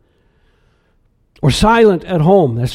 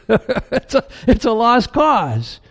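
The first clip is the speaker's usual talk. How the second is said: laughing; 4 semitones higher than usual. Real, not acted. Laughing at own joke.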